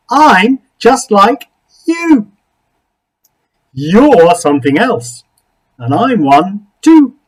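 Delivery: high, slow, earnest creature voice; eager creature. Acted. Creature insists to Something Else they are alike, slow and eager. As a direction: lean in and insist.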